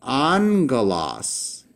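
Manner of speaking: angelos said slowly as ANG-geh-los, the first gamma sounding as ng; Greek pronunciation. Shown as a demonstration. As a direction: say angelos with an ng sound, not a hard double g. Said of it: "you would pronounce this".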